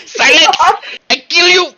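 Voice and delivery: loud, high mock-threatening shout; yell. The person yells at the gigglers, high mock shout. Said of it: "And the person yells".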